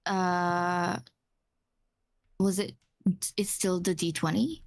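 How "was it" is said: usual speaking voice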